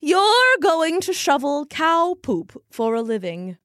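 grand carnival seer voice; mystic soothsayer. Acted. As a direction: proclaim it like a stage psychic. Fortune teller mocking the boy.

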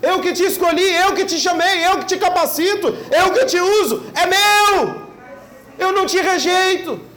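high, loud fervent preaching; full-force preaching. Acted. Pastor voices God to discouraged ministers, fervent and loud.

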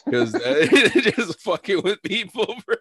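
loud, high burst through laughter; real laughing. Real, not acted. Explaining the Joker prank to a friend, cracking up. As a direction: break up laughing as you explain.

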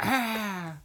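high mock scream; short, high-pitched imitation shriek; imitated yell. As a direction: mimic a wrestler's wordless yell. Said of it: "And then she's like".

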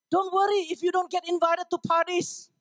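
high-pitched, pushed reassurance; mock-pleading preacher's cry; animated exhortation. Real, not acted. High-pitched exhortation, same scolding manner.

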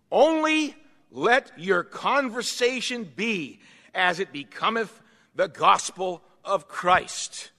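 slow, emphatic Bible reading; scripture reading. Acted. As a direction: proclaim the verse deliberately, with weight. Reads the Bible verse aloud.

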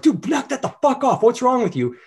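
high-pitched angry outburst; pissed-off yelling. Shown as a demonstration. Someone pissed telling a dude to knock it off, high-pitched mock yell. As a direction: blow up at him, pitch jumping way up. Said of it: "if someone yells".

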